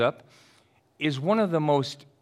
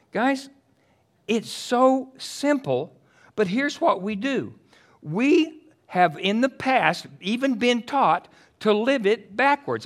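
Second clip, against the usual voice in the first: impassioned preaching; 10 semitones higher, 6 dB louder, slower than usual. Acted. Preacher pressing the point.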